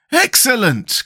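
high-pitched exclamation of approval; delighted cry. A narrator's reading. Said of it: "cried the Scarecrow".

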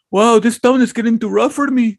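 high-pitched mock bartender voice; alarmed bartender. Bartender getting up to leave, high mock voice. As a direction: imitate the barman, pitched well up.

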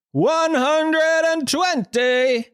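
high, hugely stretched darts referee call; silly darts voice. Pretend. Podcaster marking episode one hundred and twenty, silly darts-caller voice. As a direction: bellow out the score, drawing every word right out. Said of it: "that silly darts voice".

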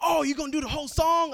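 high, loud eager fan voice; imitating fans. Fans ask for the song, high eager voice. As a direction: mimic a pushy fan. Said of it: "People are like".

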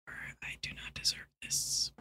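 exasperated breath of prayer; whispered prayer. Pretend. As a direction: whisper a weary plea to heaven.

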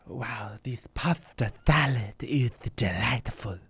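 breathy sinister whisper, no voice; creepy whisper. Shown as a demonstration. Friend requested the line, answered with a creepy whisper. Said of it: "I want you to say".

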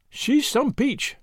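slow and casual, lightly conceding; careless. A narrator's reading. Tuppence allowing that the woman is a stunner, carelessly. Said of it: "allowed Tuppence carelessly".

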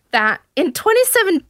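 put-on high, botched Manchester accent; attempted Manchester accent. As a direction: start a Mancunian impression. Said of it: "try and do it in Manchesterian".

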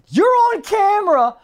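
imitating a man near tears, loud and very high; slow, high mock-hysterical accusation; hyped-up. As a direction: wail the accusation, drawn out. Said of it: "he's so hyped that he starts to cry".

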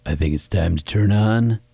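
low, smooth sexy voice. Pretend. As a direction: put on a low seductive voice. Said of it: "What's that voice?".

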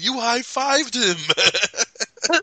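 high, very drawn-out accusation; gleeful mock taunt. Host quoting favorite taunt at captain, high drawn-out glee. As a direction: stretch it out in high-pitched glee.

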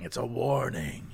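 slow, soft ominous warning; spooky hushed warning. Shown as a demonstration. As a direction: breathe it out like a ghostly omen. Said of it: "repeated take".

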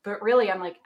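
usual speaking voice

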